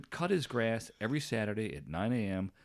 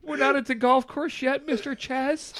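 mock retort; 9 dB louder than usual. Mocking retort to creepy neighbour.

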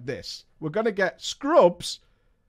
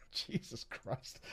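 whispered, slow and low; breathless aside; 6 semitones lower, 19 dB quieter, slower than usual. Real, not acted. Hushed disbelief. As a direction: streamer reacts to the deadly boss, whispering. Aghast at boss name.

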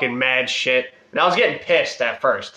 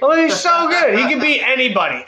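much higher, louder fanboy gushing; mock gushing; 12 semitones higher, 4 dB louder than usual. Costumed fan praising Cena to hecklers, gushing loudly. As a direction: overdo the adoring fan.

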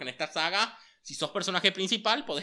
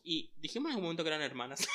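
shocked realization; 15 dB louder, faster than usual. Real, not acted. Sudden realization, loud laugh.

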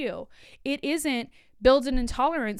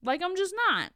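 defiant; 8 semitones higher than usual. Real, not acted. Firm self-assertion.

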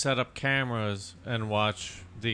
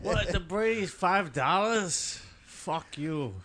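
put-on Boston accent; 4 semitones higher, slower than usual. Mock Boston sandwich shop.

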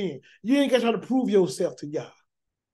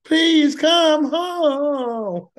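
very slow, higher, drawn-out plea; pleading parent; 6 semitones higher, 4 dB louder, much slower than usual. Retold or imitated. Preacher voicing a parent begging a proud child, slow pleading.

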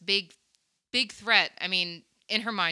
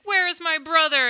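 higher, louder demand; demanding Sarah; 10 semitones higher, 9 dB louder than usual. Retold or imitated. Sarah confronts the Goblin King, high and loud. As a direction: press him to say where her brother is.

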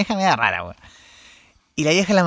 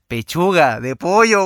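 "Pechuga de pollo" drawn out slowly; mother's clarification; 4 dB louder, slower than usual. Retold or imitated. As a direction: spell out "Pechuga de pollo" word by word. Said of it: "Y mi mamá cagá la risa y me dice". ("Pechuga de pollo" dicho lento, aclarando)

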